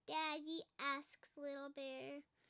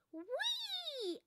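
a much higher delighted squeal; gleeful whee; 12 semitones higher than usual. A narrator's reading. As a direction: let it fly like a child leaping. Cub jumps onto the sofa.

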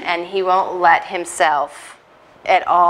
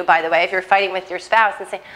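normal talking voice

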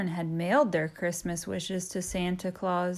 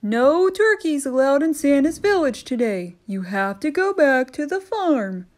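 louder, higher stern elf; christmas elf; 6 semitones higher, 8 dB louder than usual. Acted. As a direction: send the turkey packing. Elf voiced.

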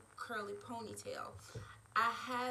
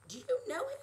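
high, eager gasp of a question; excited surprise; 12 semitones higher, 5 dB louder, much faster than usual. A narrator's reading. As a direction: squeal it out, thrilled. Kathy excited at approaching man.

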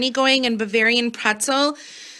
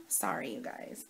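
whispered aside; 5 semitones lower, 18 dB quieter than usual. Real, not acted. Aside while cat climbs up.

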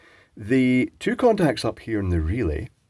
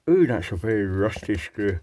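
talking around a torch in his mouth; words muffled by a torch held in the teeth; mouth full. Real, not acted. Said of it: "I got a torch in my mouth".

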